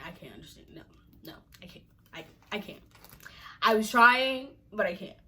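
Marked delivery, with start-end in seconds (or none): 0.1-2.8 softer, breathless whisper; whispered disbelief. Real, not acted. Giving up on understanding the statement, whispered. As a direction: mutter it under your breath in defeat.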